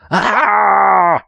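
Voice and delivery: high, loud fake scream of fright; hammed-up scream. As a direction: yelp like a cartoon coward. Cry out in fear.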